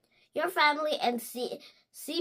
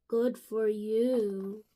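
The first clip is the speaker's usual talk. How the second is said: lower, slow deadpan; unimpressed slow deadpan; dry reply; 6 semitones lower, slower than usual. Acted. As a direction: say it dryly. Piggy replies dryly.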